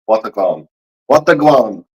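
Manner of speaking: low-pitched Jamaican greeting; Jamaican accent. Shown as a demonstration. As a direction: try out a Jamaican accent on request. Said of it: "let me hear you do the Jamaican accent".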